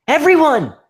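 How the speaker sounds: shrieking in terror, very high; terrified scream. A narrator's reading. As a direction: scream it in panic. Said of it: "she screamed in terror".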